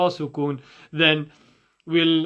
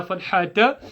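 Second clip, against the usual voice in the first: usual speaking voice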